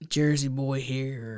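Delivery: slow, low imitation of a Jersey accent; low Jersey-accent echo of a caller. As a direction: draw out a mock Jersey accent, softly. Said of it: "I love your accent".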